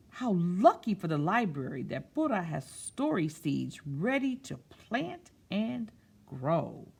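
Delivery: picture-book narration; storytime reading. A narrator's reading. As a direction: read it to a room of children. Reads picture book aloud.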